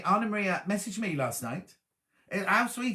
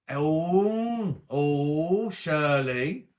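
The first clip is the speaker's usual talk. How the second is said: long drawn-out teasing "ooh"; mock scandalised; 4 dB louder, much slower than usual. Real, not acted. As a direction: tease them as if scandalised. Teasing a viewer's flirty remark.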